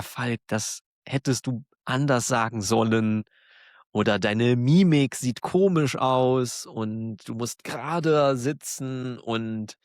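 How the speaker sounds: ordinary talk, nothing marked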